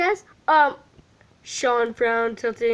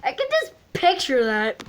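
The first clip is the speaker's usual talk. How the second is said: plain reading voice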